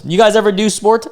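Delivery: high teasing jab at the line; mocking high question. Real, not acted. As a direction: tease the viewers about it.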